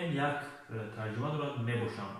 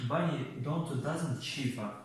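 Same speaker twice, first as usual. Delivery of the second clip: normal talking voice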